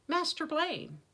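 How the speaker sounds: high-pitched, startled call; alarmed call. A narrator's reading. Elderly servant seeing his master armed, alarmed call.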